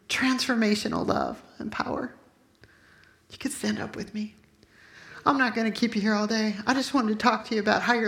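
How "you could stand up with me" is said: soft invitation. Real, not acted. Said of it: "invites congregation to stand".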